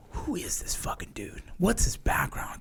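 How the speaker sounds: hushed unvoiced whisper; whispered aside. Soldier sizing up the instructor, hushed whisper. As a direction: whisper it so he can't hear. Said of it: "I looked at him like".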